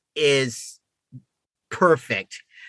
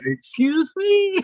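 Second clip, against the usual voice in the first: slow, much higher mock-offended "Excuse me"; indignant excuse me; slower than usual. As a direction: play the affronted listener cutting her off. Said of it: "Or then I would say".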